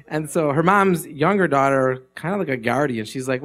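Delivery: ordinary delivery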